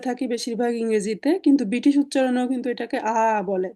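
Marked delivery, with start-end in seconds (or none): none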